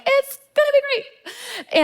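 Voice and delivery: a sunny, bubbly friend's voice, slowed and lifted; imitating her cheerful friend. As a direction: chirp it like the eternal optimist. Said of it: "she's always like".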